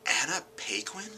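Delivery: high, questioning disbelief; incredulous. Retold or imitated. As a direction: say the name as a baffled question. Reacting to finding out who voiced him.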